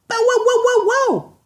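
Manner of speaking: very high loud barks; barking dog. Acted. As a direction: bark like an excited dog.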